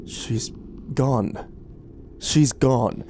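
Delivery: broken grief building to a loud wail; halting at first, then cry it out slowly; choked out, grieving. A narrator's reading. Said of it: "he choked out between sobs".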